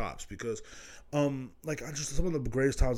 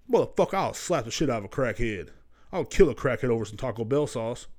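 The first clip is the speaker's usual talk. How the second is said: ordinary talk, nothing marked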